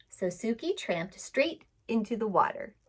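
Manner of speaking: ordinary delivery, nothing marked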